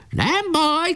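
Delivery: high defiant cry; shouted. A narrator's reading. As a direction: shrug off the hit with a shout. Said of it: "kiáltotta".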